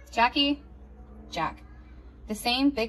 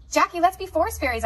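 put-on girl voice; 7 semitones higher, 5 dB louder than usual. Child voice inviting.

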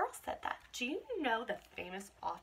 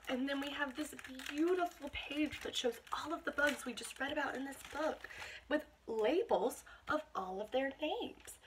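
usual speaking voice

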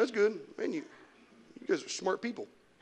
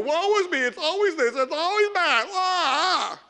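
higher, louder, whining mock lament; mock woe-is-me; 9 semitones higher, 4 dB louder than usual. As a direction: groan it like a grumbler, overdone. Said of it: "so many people".

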